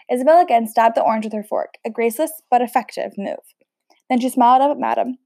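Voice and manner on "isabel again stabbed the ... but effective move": ordinary delivery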